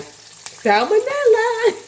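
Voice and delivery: high, slow, drawn-out punchline; spooky stretched-out word; mock-dramatic. Real, not acted. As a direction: ham up the horror.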